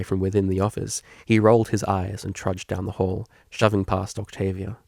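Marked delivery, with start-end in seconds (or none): none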